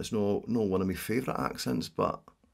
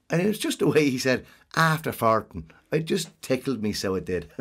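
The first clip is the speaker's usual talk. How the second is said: put-on Dublin accent; 3 semitones higher, 4 dB louder than usual. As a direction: spin a yarn in nasal Dublin Irish. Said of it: "when you're from Dublin, people tend to talk a little bit nasal".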